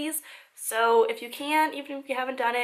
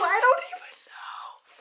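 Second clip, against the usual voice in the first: whisper; 13 semitones higher than usual. Real, not acted. Baffled by a mystery item.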